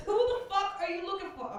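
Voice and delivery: yelled up at the sky, half laughing; mock yell. Comic yelling at helicopter.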